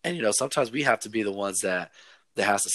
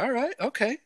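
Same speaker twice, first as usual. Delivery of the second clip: grudging okay. Retold or imitated. Mock reply to rival fans.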